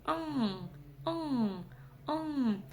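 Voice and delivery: «н» не дотягиваясь языком до нёба; тяни «н», не касаясь нёба кончиком языка; носовое «н» с хвостиком. Shown as a demonstration. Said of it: "кончиком языка потянуться". (протяжное казахское «н» с хвостиком)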